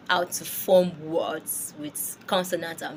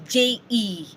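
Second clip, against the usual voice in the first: usual speaking voice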